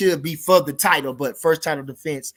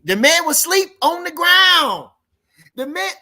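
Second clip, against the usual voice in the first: high, loud, incredulous-style exclamation; excited exclamation; 12 semitones higher, 8 dB louder than usual. Real, not acted. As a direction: cry out the knockout, high and loud. Excitedly recounting an emphatic knockout.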